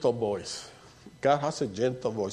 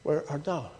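quieter, tender asking; soft gentle question; 6 dB quieter than usual. Retold or imitated. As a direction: let it fall softly as a question.

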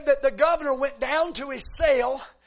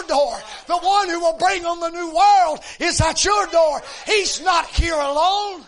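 preaching at full force; 3 semitones higher, 5 dB louder than usual. Acted. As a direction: call it out to the whole church. Preacher's opening proclamation.

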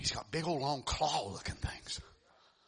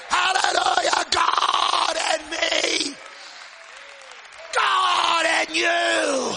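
loud, high, shouting sermon delivery; thundering revival preacher; full-force preaching; 10 dB louder than usual. Acted. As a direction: preach it to the back row.

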